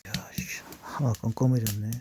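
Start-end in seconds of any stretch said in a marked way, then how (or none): 0.1-0.6 muttered whisper. Real, not acted. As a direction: say it under your breath. Whispered aside. (혼잣말 속삭임)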